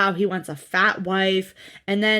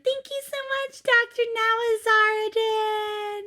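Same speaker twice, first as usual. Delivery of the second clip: put-on very high, held singsong baby talk; mock her cutesy squeal, dragging out the name; 13 semitones higher than usual. Podcaster mimicking the patient thanking her surgeon, baby voice. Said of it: "You don't need to do the baby voice".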